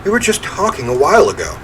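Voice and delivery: ordinary talk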